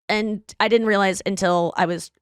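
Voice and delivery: ordinary delivery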